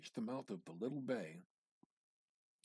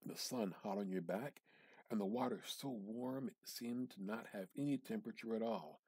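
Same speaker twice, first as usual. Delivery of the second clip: usual speaking voice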